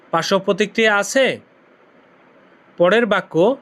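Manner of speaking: ordinary talk, nothing marked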